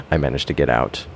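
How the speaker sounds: ordinary delivery, nothing marked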